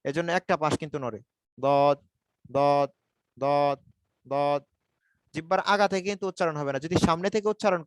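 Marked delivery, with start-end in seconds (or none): 1.6-4.6 "দদ" drawn out from one side of the tongue; heavy, held "দদ", tongue edge on upper molars; side-of-tongue articulation. Shown as a demonstration. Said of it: "আমি একটা পাশ থেকে উচ্চারণ করতেছি". (জিহ্বার এক পাশ থেকে টেনে "দদ" উচ্চারণ)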